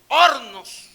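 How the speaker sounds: "hornos" belted out high and loud, falling; shouted hornos. Acted. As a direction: hit "hornos" with a full shout. Preacher stressing his ovens.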